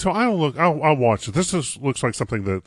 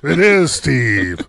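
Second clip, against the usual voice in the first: pirate voice; 4 semitones lower, much slower than usual. Pretend. Pirate day banter.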